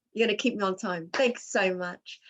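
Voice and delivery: usual speaking voice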